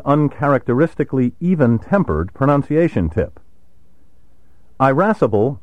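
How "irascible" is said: irascible opened with a long "eye" sound; long initial I. Shown as a demonstration. Narrator introducing the word's alternative pronunciations, long initial I in irascible. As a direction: say irascible as eye-RASS-ible. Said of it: "reflects the quality of the vowel".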